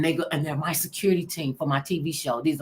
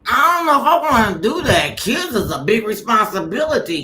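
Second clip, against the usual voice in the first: laughing reluctance; 6 semitones higher, 9 dB louder than usual. Retold or imitated. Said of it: "And I was like".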